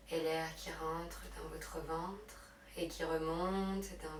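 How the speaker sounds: hushed, unhurried meditation guidance; guided relaxation. Real, not acted. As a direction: guide them gently into breath and rest. Leads yoga relaxation and breathing.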